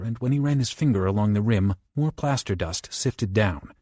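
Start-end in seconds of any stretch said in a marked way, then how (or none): none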